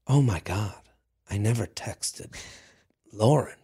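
low, hushed mock gasp; hushed realization. Imagining the date's realization, hushed low. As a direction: breathe it out as a sudden low realization. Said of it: "he didn't get home and go".

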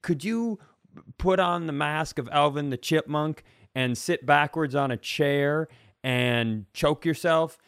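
usual speaking voice

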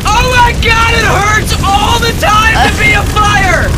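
very high, loud, shrieking complaint; wailing fire voice. Acted. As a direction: shriek like a suffering talking fire. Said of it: "you just hear, like".

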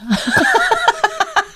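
high burst of laughter; breaking up laughing. Real, not acted. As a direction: crack up mid-bit. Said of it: "I can't".

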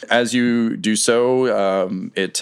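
ordinary delivery

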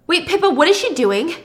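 high, loud interruption; alarmed. Acted. Thomas spotting the neighbor's odd move, alarmed. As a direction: cut the quarrel short in alarm.

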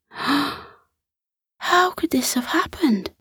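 high, slow, whispered dismay; a bunny stunned by the goddess's news; shocked cry. Acted. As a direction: gasp the question out in disbelief. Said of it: "Bertie cried".

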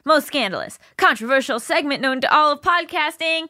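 big booming ring announcer, stretched out; boxing match announcer. Acted. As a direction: hype up the segment like a title fight. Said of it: "I'm adding, like, an announcer voice".

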